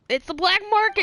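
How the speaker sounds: excited. Real, not acted. Excited realisation.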